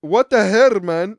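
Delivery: hammed-up angry demand; mock mad caller. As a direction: imitate someone fed up, raising the voice. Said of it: "that was the one that was getting mad at me".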